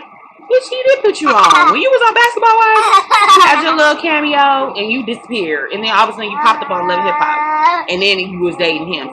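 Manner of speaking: high, loud heated comeback; heated retort. Retold or imitated. Clapping back at the girl about being put on, heated loud retort. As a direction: fire back at her, worked up. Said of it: "But I'm like".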